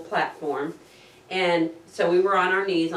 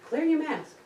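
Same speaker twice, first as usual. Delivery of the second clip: high-pitched mock command; insistent instruction; 10 semitones higher than usual. Instructor to student with a flooded mask, high insistent call. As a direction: call the order out high, without shouting.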